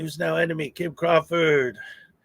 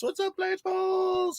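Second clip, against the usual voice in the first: high-pitched hail; 13 semitones higher, 5 dB quieter than usual. Real, not acted. Streamer hails a chat regular, playful high-pitched hail. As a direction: squeal the greeting up high without volume.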